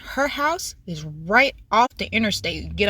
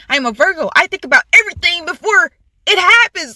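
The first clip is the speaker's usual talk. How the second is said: high, louder, worked-up comeback; retold exasperation; 8 semitones higher, 5 dB louder than usual. Retelling the quarrel with her friend, exasperated. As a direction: argue your case, pitched up and insistent.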